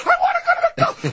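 put-on very high, wailing child; screaming kid. Kid begs parent for McDonald's, screaming. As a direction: shriek a child's demand. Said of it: "their eight screaming kids".

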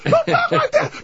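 very high shrieked scolding; screaming family quarrel. Acted. Family argues in the station wagon, screaming. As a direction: scold at the top of the range.

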